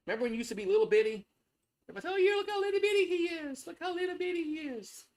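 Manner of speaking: put-on very high, fast doting baby talk; baby talk to the dog. To his dog, remembering it as a puppy, very high doting baby talk. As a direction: gush over the dog like it's still a tiny puppy.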